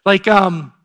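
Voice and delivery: usual speaking voice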